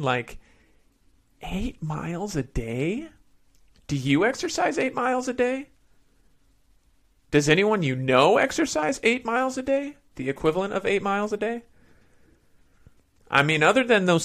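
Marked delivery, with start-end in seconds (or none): 1.4-11.6 rising disbelief, pitched up; asking in disbelief; incredulous. Real, not acted. Incredulous repeated question.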